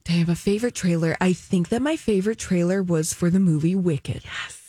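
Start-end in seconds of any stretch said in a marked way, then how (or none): none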